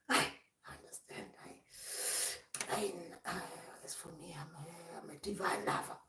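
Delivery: very soft whisper; barely voiced, hushed act; hushed understanding voice. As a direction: drop right down to a whisper. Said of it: "You start talking like that".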